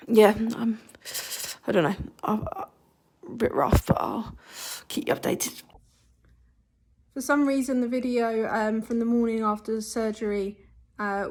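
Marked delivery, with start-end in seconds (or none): 0.1-5.6 whispering. Real, not acted. Weak, rough after surgery.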